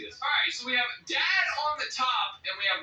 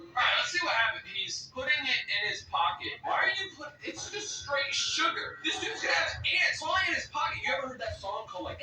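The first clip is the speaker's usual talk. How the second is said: normal talking voice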